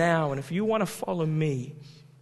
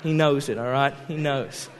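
normal talking voice

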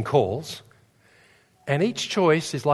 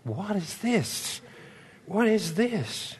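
slow, high, hushed wondering; bewildered inner voice; 5 semitones higher, 8 dB quieter, much slower than usual. Retold or imitated. Preacher recalls his own bafflement, slow hushed wonder. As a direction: puzzle over it quietly to yourself. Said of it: "I'm thinking".

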